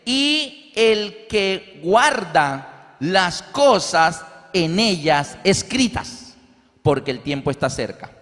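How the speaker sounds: slow, solemn Bible reading; scripture reading. Acted. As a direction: read the verse slowly and weightily. Lee el texto bíblico. (lectura solemne y lenta del versículo)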